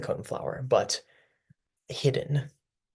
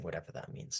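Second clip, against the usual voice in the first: whispered aside; 5 semitones lower, 15 dB quieter, faster than usual. Real, not acted. Whispered aside after ominous scene.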